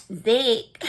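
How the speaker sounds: imitating how another woman says the name "Zach"; "Zach" said as "Zick", playful mimicry; mock pronunciation of "Zach" as "Zick"; Karen. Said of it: "I gotta say it like Karen said".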